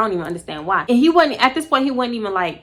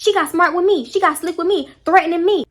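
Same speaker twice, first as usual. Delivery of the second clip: high pitched imitation of Pygmy; Pygmy playing victim in front of everyone, high mock whine; mock whiny complaint; 9 semitones higher, 4 dB louder than usual.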